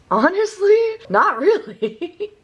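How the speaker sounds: high, loud answer breaking into laughter; laughing reply. Retold or imitated. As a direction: squeal it out, laughing, drawing out the end. Laughs through her retold answer.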